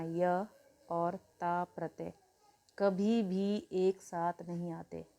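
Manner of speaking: slow, word by word, for students to write down; each word of "य और ता प्रत्यय कभी भी एक साथ नहीं आते" spaced out slowly; slow dictation. Shown as a demonstration. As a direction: dictate the rule slowly so they can note it. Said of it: "note बना के लिख लेना".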